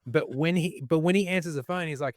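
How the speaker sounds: ordinary talk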